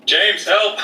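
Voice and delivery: high-pitched call for rescue; comic panicked yelp; mock cry for help. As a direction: call out high in play-acted alarm. Player acts calling out for help.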